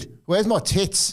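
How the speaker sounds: very high slow mock Australian; Australian accent. Shown as a demonstration. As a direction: ham up a drawling Aussie bloke. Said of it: "in an Australian accent".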